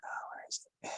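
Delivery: whispered to self. Real, not acted. Searching screen share, muttering to self.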